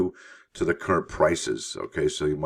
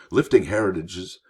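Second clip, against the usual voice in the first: normal talking voice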